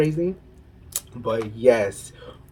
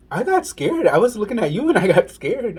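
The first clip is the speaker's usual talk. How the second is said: higher, a bit louder scared guy; mock scared man; 9 semitones higher, 4 dB louder than usual. Fellow passenger telling her he got scared, higher voice. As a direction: voice the guy admitting his fright.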